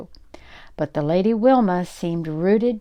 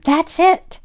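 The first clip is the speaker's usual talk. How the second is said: high, loud burst of discovery; excited exclaim; 11 semitones higher, 7 dB louder than usual. A narrator's reading. Jack shouts his sudden idea to the butler, excited. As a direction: shout it like lightning struck. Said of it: "he exclaimed".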